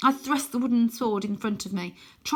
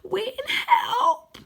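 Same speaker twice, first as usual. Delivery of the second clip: high, loud plea for help; desperate cry; 5 dB louder than usual. A narrator's reading. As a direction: cry out as you're lifted away. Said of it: "I manage to cry".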